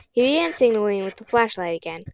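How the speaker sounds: plain reading voice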